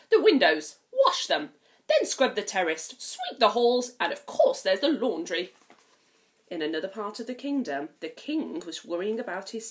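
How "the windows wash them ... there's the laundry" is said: bossy stepmother. Acted. Stepmother orders Cinderella through the chores, loudly. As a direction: rattle off orders like a cold stepmother.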